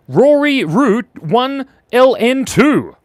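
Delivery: slow, careful read-out; slow and loud. Real, not acted. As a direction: spell it out slowly and loudly. Reading out an awkward username.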